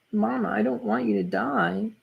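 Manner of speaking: lightly voiced frightened boy; pleading child. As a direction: beg your mother not to die. Child begs his mother.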